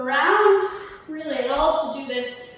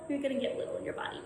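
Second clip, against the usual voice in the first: much softer and quick, words made tiny; small and soft; 8 dB quieter, much faster than usual. Shown as a demonstration. Teacher showing the class how little feels, said small and soft. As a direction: shrink the voice to show getting little. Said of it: "when I say get little".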